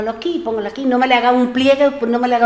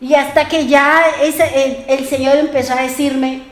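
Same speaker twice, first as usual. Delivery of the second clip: ordinary delivery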